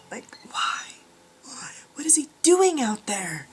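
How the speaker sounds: real whisper so he won't hear; curious whispered aside. Real, not acted. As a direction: hush it, eyes on the door. Husband can hear her.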